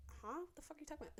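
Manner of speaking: mild imitation of a baffled friend; baffled huh. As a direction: sound genuinely lost. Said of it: "Danny's like".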